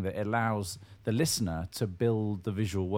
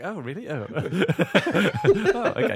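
high, slow, laughing surprise; drawn-out taken-aback oh; surprised; 9 semitones higher, 7 dB louder, much slower than usual. Retold or imitated. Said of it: "I was like".

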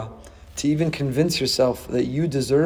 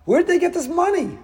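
indignant refusal; 9 semitones higher than usual. Retold or imitated. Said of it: "Someone would say".

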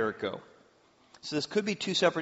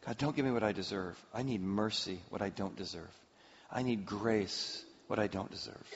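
quiet plea; 7 semitones lower, 10 dB quieter than usual. Acted. Prayer voiced for sinner seeking mercy.

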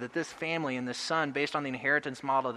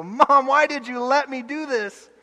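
put-on high, loud, slow childish wail; a burnt child wails at his mom, high and loud; whiny child; 11 semitones higher, 8 dB louder, slower than usual. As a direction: wail like a burnt little kid. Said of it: "that infancy child in me is gonna go".

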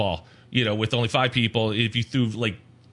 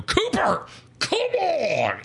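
calling out; much slower than usual. Retold or imitated. Dad yelling at son's game.

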